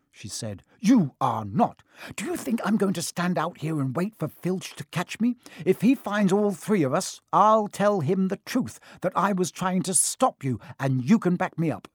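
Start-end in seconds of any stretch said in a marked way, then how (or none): 0.8-1.8 slow, loud, emphatic contradiction; adamant, weighty no; firm refusal. Acted. Ron flatly refuses Hermione. 2.2-11.9 high-pitched bossy insistence; heated, self-righteous protest; indignant Hermione. Acted.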